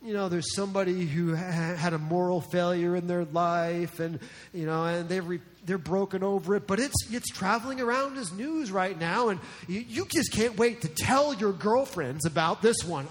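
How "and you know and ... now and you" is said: ordinary talk, nothing marked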